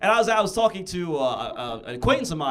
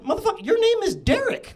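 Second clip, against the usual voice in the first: high indignant retort; 3 dB louder than usual. Retold or imitated. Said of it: "I was like".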